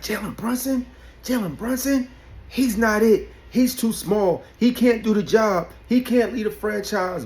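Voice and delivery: high, scoffing doubter voice; mock the doubters up high. Imitating critics dismissing Brunson, high and scoffing. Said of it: "Everybody was like".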